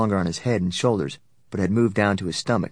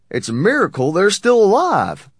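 high, loud amazement; indignant exclamation; 11 semitones higher, 8 dB louder than usual. A narrator's reading. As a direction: exclaim it at full voice. Tall man exclaims in outrage.

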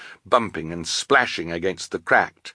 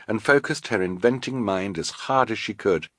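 plain reading voice

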